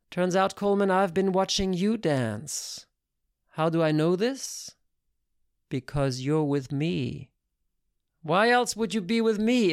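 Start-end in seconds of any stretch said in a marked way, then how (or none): none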